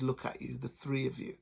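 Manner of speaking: soft, ominous accusation; cold quiet. A narrator's reading. Husband's cold quiet opening.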